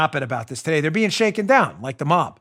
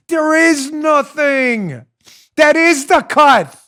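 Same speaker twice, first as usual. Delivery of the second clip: slow, loud, emphatic shout; 10 semitones higher, 7 dB louder, much slower than usual. Real, not acted. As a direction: hammer each word out loud. Ranting host hammers his point.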